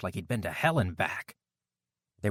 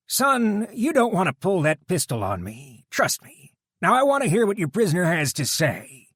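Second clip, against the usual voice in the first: biker deputy voice; 10 semitones higher, 6 dB louder than usual. A narrator's reading. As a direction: coolly call out the guard's threat. Dutch voiced.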